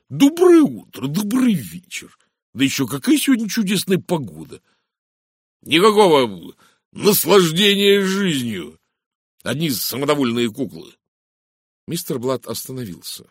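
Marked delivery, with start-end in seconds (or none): 0.1-2.2 very high, put-on prim greetings; mocking imitation of cool acquaintances; mock icy greeting. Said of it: "только ледяным тоном". 2.5-10.9 high, exasperated mockery; mock the guests, pitch lifted; aggrieved, theatrical complaint; scornful rant. A narrator's reading. Blatt's scornful rant about guests.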